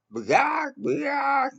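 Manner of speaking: shrill, very high squawking bird call at full volume; loud bird squawk. Retold or imitated. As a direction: screech it out like a startled bird, as loud as you can. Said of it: "as loud as I can".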